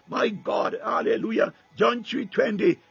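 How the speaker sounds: usual speaking voice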